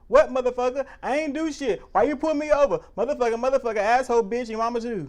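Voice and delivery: mock angry cursing. Driver cursing out the cop who pulled him over, furious yelling. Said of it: "It's either I'm gonna be".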